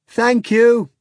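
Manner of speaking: high, loud grateful shout. A narrator's reading. Natasha thanks Margarita, loud shout. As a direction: shout thanks as she flies off. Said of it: "Natasha shouted".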